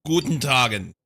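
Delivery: loud mock German accent; hammed-up German, punched out; German greeting. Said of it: "I sound like a true German".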